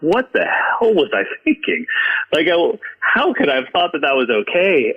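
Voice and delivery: high-pitched, exasperated self-reproach; appalled disbelief. Retold or imitated. Drummer hearing his old recordings, appalled and incredulous. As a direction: cringe at your younger self, voice jumping up.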